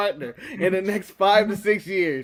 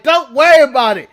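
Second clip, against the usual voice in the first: cocky reassurance; 4 semitones higher, 7 dB louder than usual. Retold or imitated. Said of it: "And I was like".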